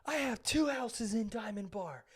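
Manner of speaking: high-pitched mock indignant rant; whiny mock tirade of an entitled customer; imitated yelling. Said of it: "She's, like, yelling at it".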